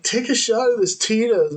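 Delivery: lightly voiced drunk partier; stoned guy voice. Retold stoned guy offering drink.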